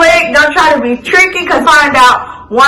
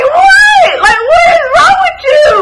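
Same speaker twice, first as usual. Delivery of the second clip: much higher, fast, tearful yell; crying shout. Retold or imitated. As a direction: scream it through tears at her mother. Yelling at mom following her.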